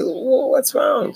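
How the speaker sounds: high, slow, drawn-out innocent question; puzzled pastor. Retold or imitated. Pastor asking the watching church lady, high and slow. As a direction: play the man who has no idea why he's being watched.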